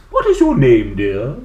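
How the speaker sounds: a grand teacher asks gently but boldly; dance teacher. Acted. Teacher asks the dinosaur her name, loud and grand.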